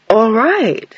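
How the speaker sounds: agree, but without conviction; uncertain. A narrator's reading. Countess agreeing to meet a stranger, uncertain. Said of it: "But she sounded uncertain".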